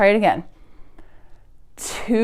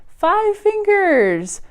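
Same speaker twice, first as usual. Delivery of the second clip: excited finish; 11 semitones higher, much slower than usual. Acted. Proud finale of counting.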